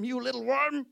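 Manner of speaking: high, loud furious cry; angry yell at worm. Acted. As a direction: curse the little worm. Jonah shouts at the worm.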